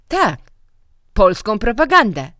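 loud, high accusing cry; exclaimed. A narrator's reading. Director shouting at the village teacher. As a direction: fling the word back at the teacher. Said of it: "zawołał Jaczmieniew".

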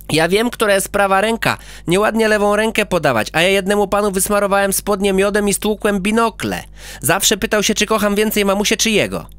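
put-on proud child listing his nicknames; boastful little boy voice; boy character.

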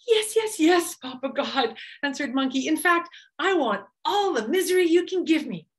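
high, excited monkey yelping yes; bouncing with greed; eager Monkey voice. A narrator's reading. Monkey insisting to Papa God, high and excited.

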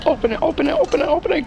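high frantic pleading; panicking gamer. Real, not acted. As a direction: panic at the door. Frantic in horror game.

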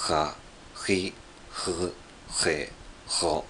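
"Ha, ri, ru, he, ro" with a rough, fricative throat R; struggling French R, scraped in the throat on "Ha, ri, ru, he, ro"; attempted French R. Shown as a demonstration. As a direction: practise "Ha, ri, ru, he, ro" as separate French-R syllables. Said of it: "全くできないです".

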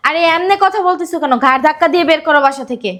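high, heated command; angry urging. Acted. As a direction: egg them on to throw him out. Urging forcible eviction of visitor. (চড়া রাগী গলায় উসকানি)

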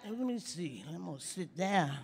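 ordinary talk, nothing marked

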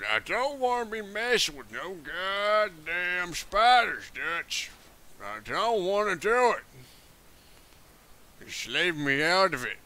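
slow, weary western drawl; cowboy outlaw voice. Acted. Outlaw grumbles to Dutch about spiders, slow drawl. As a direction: play a reluctant gunslinger talking to his boss.

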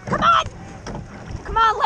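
ordinary talk

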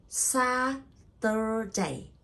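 "Saturday" very slowly as SA, TUR, DAY; syllable by syllable. Shown as a demonstration. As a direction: stretch and separate all three syllables of "Saturday". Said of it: "three syllable".

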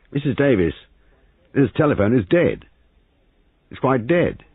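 high, forceful announcement; irritable. A narrator's reading. Major telling hostess the telephone is dead, irritable. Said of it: "rattling the telephone irritably".

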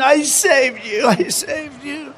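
slow, high, fading plea; mock dying lament; betrayed woman. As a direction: plead with the snake in dying dismay. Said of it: "cried the woman".

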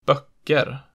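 put-on böcker in Old Stockholm dialect with accent two. Shown as a demonstration. Teacher showing century-old local speech, Old Stockholm accent two on böcker. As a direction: give böcker the Old Stockholm double-peak tone. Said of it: "generalized accent two onto everything else".

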